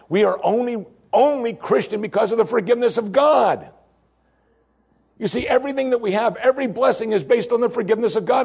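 high, fervent sermon voice; impassioned preaching. Real, not acted. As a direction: lift the congregation with conviction. Preaching peak.

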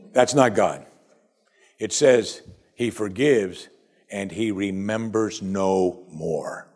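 normal talking voice

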